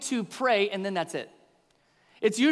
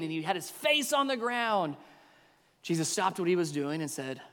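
ordinary delivery

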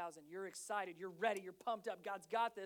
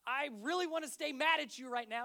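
louder, higher frustrated dad line; exasperated outburst; 5 semitones higher, 9 dB louder than usual. Retold or imitated. Dad to messy kids, exasperated loud outburst. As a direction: blurt it out like a fed-up dad.

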